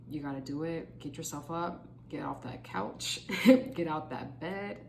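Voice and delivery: ordinary talk, nothing marked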